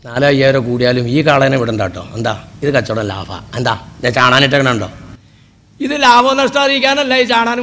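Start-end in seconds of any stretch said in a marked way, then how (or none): 0.1-2.3 much lower, coaxing broker voice; cattle broker. Retold or imitated. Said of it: "ചാണാനിട്ടാ പറയും". 2.6-4.9 much lower, coaxing broker voice; cattle broker. Retold or imitated. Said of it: "ചാണാനിട്ടാ പറയും".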